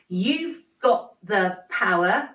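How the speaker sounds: slow, got and power punched; stress on got and power. Shown as a demonstration. Teacher showing the kids, slow stress on got and power. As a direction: drive got and power home. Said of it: "There's that got and power again".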